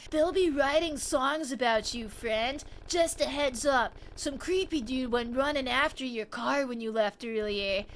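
stoned alley stranger asking for bus money. Acted. Voices the stranger warning.

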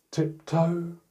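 plain reading voice

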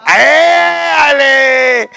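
long, high, loud hollered ¡Éale!; drawn-out cheer. Real, not acted. Host hollering at the crowd, a long festive cheer. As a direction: holler it out slow and stretched. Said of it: "drawn-out hailing shout".

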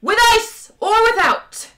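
very high, very loud bellow; full furious high shout; enraged bellow. A narrator's reading. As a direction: bellow a waiter's question like an interrogator. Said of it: "bellowed number two".

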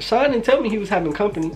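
high mom voice, mildly put out; a mother caught off guard by a guest, pitched high; high mock-mom complaint; imitating his mom. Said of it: "She was like".

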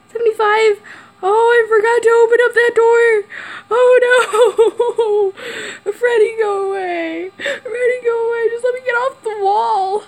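high fearful whimpers; fearful whimpering. Real, not acted. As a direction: plead in fear, near tears. Freddy attacking, stuck on wall.

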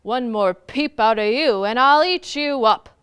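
loud bear warning, rising in force; stern, booming threat; frowning bear threat. A narrator's reading. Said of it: "The bear frowned".

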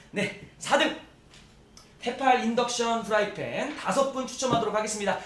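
loud, high, big draw-night announcing; booming prize announcer. Acted. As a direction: boom it out like a game show host.

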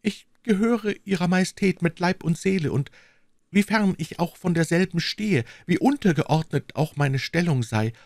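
high, devoted and tender; the maid pledges loyalty, gently; reassuring young woman. Acted. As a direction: comfort the queen, earnestly.

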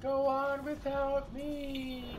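high, slow hammed-up heroic farewell; overacted movie hero; dramatic hero. Mock heroic dying line.

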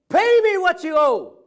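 high, loud furious demand with a hand on the throat; angry demand shout. Acted. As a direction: bellow the debt like a choking creditor. Servant choking his fellow debtor.

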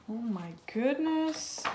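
gasp. Acted. Narrator gasps at the chase.

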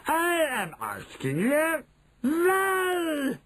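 high piercing witch scream. Acted. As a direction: shriek the question at them. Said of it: "she screamed".

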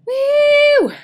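high, loud victory whoop; triumphant woo shout. Real, not acted. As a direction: let out a big victory yell. Finishing round with a yell.

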